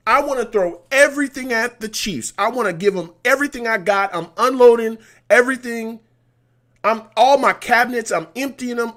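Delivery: loud, high, pumped vow to go all out; fired-up shouting. Real, not acted. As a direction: hype yourself up, full voice. Fired-up vow against the Chiefs.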